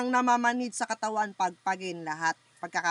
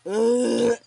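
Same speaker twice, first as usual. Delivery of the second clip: loud groaning grunts; 8 dB louder than usual. Real, not acted. Healer grunting loudly over the patient in trance. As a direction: push out each grunt from the chest.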